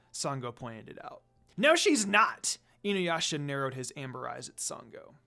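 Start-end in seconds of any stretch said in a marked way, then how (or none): 1.5-2.6 angry denial. A narrator's reading. Inuyasha defends Kikyo angrily.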